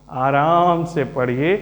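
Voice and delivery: slow, drawn-out reassurance; easygoing teacher in no hurry; relaxed, unhurried. Real, not acted. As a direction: take your time, stretch it out slowly. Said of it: "ये सुकून भरी class है".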